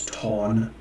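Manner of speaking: "ton" with the vowel of "ought". Shown as a demonstration. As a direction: say "ton" with an open aw sound. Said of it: "Gotta get that aw".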